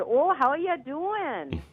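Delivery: put-on Milwaukee accent. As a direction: greet him in a hammed-up Milwaukee accent. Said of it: "started speaking in this, like, Milwaukee accent".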